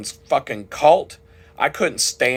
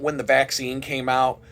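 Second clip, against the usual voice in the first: usual speaking voice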